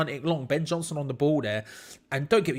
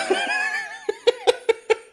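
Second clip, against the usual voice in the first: high helpless burst of laughter; cracking up at the defending; laughing; 5 dB louder than usual. Real, not acted. West Ham concede, streamer cracks up.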